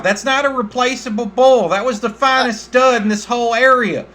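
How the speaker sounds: high, loud demanding farmer voice; indignant farmer. Acted. Farmer demanding compensation for his dead bull, high and loud. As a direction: insist on being paid, outraged.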